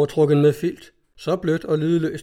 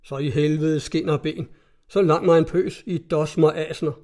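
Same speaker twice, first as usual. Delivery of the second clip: usual speaking voice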